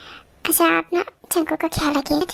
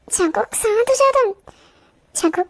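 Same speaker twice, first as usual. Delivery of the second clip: ordinary delivery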